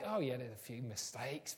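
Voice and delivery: low, soft throwaway admission; dismissive aside. Acted. Teacher noting a few mistakes, casually dismissive. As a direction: drop down and toss it off. Marker brushing off a pupil's mistakes.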